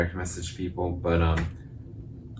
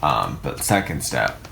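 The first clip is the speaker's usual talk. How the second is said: normal talking voice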